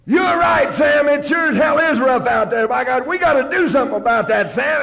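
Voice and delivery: high, loud fired-up yelling; mock rallying shout. Shouting back at Sam mid-meeting, loud sarcastic rally. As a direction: roar it like a rabble-rouser. Stood up one more time.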